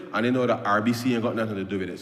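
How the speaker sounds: ordinary talk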